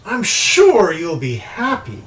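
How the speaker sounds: teasing sorceress with high, swooping pitch; imperious crystal-ball mistress. Acted. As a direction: toy with them as a scheming enchantress. Game master plays crystal-ball woman.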